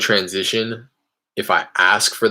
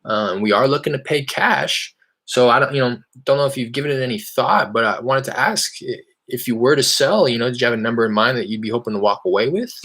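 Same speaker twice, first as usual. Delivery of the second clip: bright, higher salesman voice on a cold call; cold-call voice, higher and eager; upbeat phone pitch; 5 semitones higher than usual. Real, not acted. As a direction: sound friendly and casual to a stranger.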